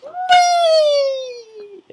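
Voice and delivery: loud, very high, drawn-out squeal; imitated fangirl squeal. Fan girl reacting to the Doctor's line, squealing. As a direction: mimic a thrilled fan squealing.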